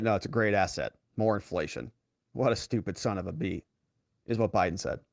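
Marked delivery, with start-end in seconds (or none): 0.0-3.6 lower, softer mock mutter; muttered under breath. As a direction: grumble it low and soft under your breath. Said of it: "Joe Biden muttered under his breath".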